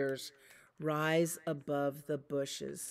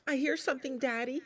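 higher, wary little cub voice; a pup noticing a noise; Little Wolf; 8 semitones higher, much faster than usual. Acted. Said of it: "said Little Wolf".

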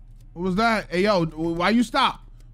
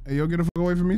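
ordinary talk, nothing marked

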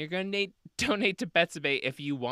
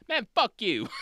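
higher-pitched mock defiance; brash retort; 8 semitones higher, 3 dB louder than usual. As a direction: play a fed-up witness telling them off. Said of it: "everybody's like".

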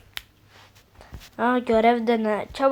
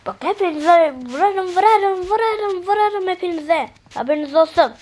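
higher, louder mock-furious threat, building then easing; angry threat; 6 semitones higher, 4 dB louder than usual. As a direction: hammer each vurarım like a cartoon bully. Threatens to hit everyone. (arkadaşlarına kızgınca bağıran tehdit, yüksek sesle)

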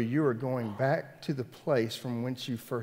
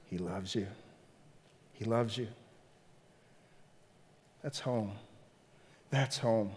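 soft, lowered voice after a build; hushed and tender; 3 semitones lower, 6 dB quieter than usual. Real, not acted. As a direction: let it land gently, with space around it. Hushed landing after loud build.

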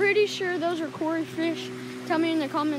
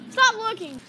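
shouting; 7 semitones higher, 5 dB louder than usual. Real, not acted. Calling out to the counting friend.

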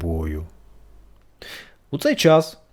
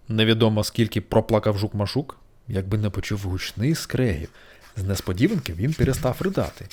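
ordinary delivery, nothing marked

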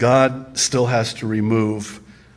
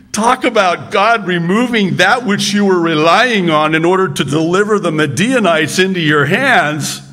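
ordinary talk

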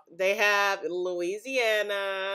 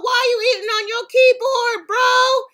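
much higher and louder, near a shriek; real shocked scolding; scolding yell; 12 dB louder than usual. Real, not acted. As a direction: shout down a reckless eater. Scolding a person in a photo.

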